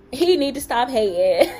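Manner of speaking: loud mock complaint breaking into laughter; playful, laughing jab; joking. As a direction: tease loudly, about to crack up. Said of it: "I'm just kidding".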